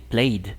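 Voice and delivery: PLAYED as one syllable ending in d; correct D ending. Shown as a demonstration. Teacher modelling the voiced ending, played as "playd". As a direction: say "played" like "playd", no extra vowel. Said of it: "esto va a sonar como D también".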